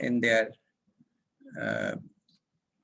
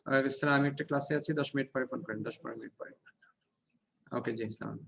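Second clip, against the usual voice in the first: hushed, soft phone reply; whispering on the phone; 2 semitones lower, 6 dB quieter, faster than usual. Real, not acted. Takes a phone call during class.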